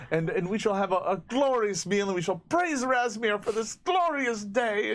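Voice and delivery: high, stammering, effusive hostess; eager farm woman. Acted. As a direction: gush devoutly while offering hospitality. Farm woman offers guests dinner.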